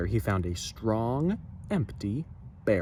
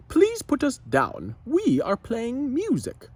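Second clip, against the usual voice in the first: higher, slower, a touch cross; annoyed Noggin; 12 semitones higher, 4 dB louder than usual. Acted. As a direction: scold the whale politely but firmly. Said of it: "Noggin was angry".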